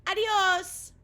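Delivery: "Adios" sung out high and loud; Spanish goodbye. Acted. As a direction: caterpillar cheerfully calls "Adios" in Spanish. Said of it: "He learned to say goodbye".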